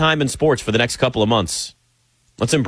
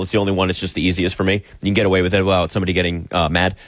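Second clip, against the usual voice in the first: normal talking voice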